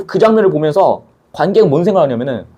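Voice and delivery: ordinary delivery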